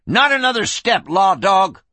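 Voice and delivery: high, loud threat; warning. A narrator's reading. Gun kid to the approaching lawman, sharp warning. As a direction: warn him off hard. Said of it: "he warned".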